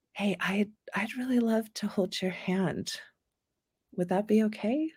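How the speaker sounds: softly asking permission; gentle, hushed request; soft and tender. Shown as a demonstration. Modeling asking to hold hands.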